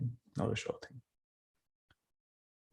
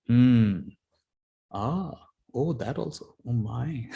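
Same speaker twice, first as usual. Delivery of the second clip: mock pondering sounds; much slower than usual. Ajahn Chah studies the villager's palm, mock-musing hums. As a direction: play a monk pretending to read fate. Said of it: "he makes all these sounds".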